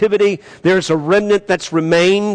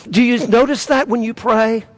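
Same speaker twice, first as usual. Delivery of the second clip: normal talking voice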